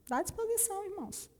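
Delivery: playful answer. Acted. Answers her own question.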